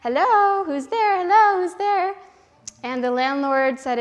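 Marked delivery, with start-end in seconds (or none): 0.0-2.1 high, loud parrot hello. Acted. Parrots answer the landlord's knock, in a loud high parrot voice. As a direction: squawk it out like a parrot at the door. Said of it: "And they said".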